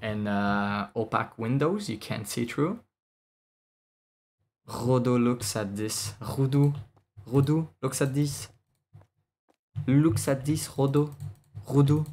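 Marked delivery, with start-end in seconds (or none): 4.7-12.0 put-on French accent. Showing his friend the room in a mock French accent. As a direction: call out like a cartoon Frenchman. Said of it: "this is racist against French people".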